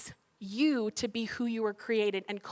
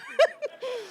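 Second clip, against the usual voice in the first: high, short laugh; burst of laughter; 11 semitones higher than usual. Real, not acted. Laughing at the editor's blunt honesty.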